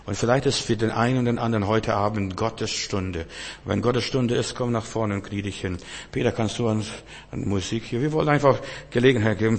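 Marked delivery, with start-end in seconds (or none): none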